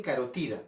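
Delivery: carotida stressed on its third syllable, TI; Spanish pronunciation. Shown as a demonstration. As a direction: lean on TI in carotida. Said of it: "En España dicen". (carotida como palabra grave, acento en TI)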